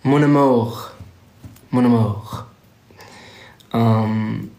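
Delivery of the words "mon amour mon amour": French phrase. Shown as a demonstration. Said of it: "that's my French".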